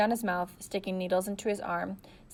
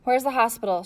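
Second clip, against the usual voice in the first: raised, urgent call over the engine; shout; 4 semitones higher, 6 dB louder than usual. A narrator's reading. Girl shouting over engine noise, loud. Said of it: "she shouted".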